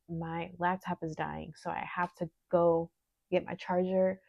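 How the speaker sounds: ordinary talk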